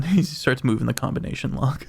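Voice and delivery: ordinary talk, nothing marked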